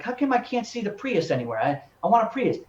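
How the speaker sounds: mock complaint. Car buyer looking on the road and never seeing it, mock-frustrated. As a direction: imitate a buyer griping in bafflement.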